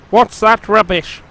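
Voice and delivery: proud engine grumbling at his new shed. A narrator's reading. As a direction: complain about the shed. Sir Handel sneering.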